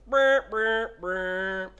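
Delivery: loud buzzing brr; playful imitated noise; mock brr noise. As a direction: make a mocking buzz sound.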